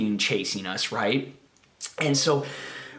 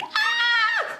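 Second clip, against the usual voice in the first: put-on high girly scream. Boy fleeing the shadow figure, high girly scream. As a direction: let out a sudden terrified squeal. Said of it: "I probably screamed like a girl".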